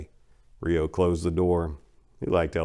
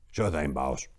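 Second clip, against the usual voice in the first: lower, softer yes-man; henchman reply; 5 semitones lower, 7 dB quieter than usual. Acted. Devon answers his boss in a low quiet voice. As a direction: mumble assent to the boss.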